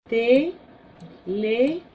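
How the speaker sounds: teléfono very slowly, te... LÉ... fo... no; syllable by syllable. Shown as a demonstration. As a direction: pause after each syllable of teléfono. Teacher splits example word into syllables. (teléfono sílaba por sílaba)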